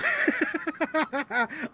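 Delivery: high, rapid giggling; real laugh. Real, not acted. Host disagreeing with a viewer comment, laughing. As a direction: burst out laughing after a firm no.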